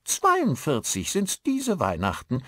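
high, slow, surly village woman; grumpy Yorkshire woman. A narrator's reading. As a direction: grumble it slowly like a Yorkshire wife. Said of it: "die Frau in mürrischem Yorkshire-Tonfall".

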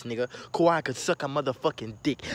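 normal talking voice

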